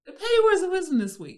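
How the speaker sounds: high, loud, laughing through the segment title; laughing announcement. Acted. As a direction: giggle out the title.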